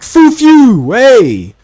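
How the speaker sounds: high, loud mock-Italian outburst; mock furious Italian street shout; angry Italian. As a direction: yell it like a hammed-up angry Italian. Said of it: "If an angry Italian came up to me".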